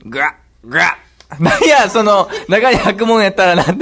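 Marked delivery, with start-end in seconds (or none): 0.1-0.9 frog croak. Acted.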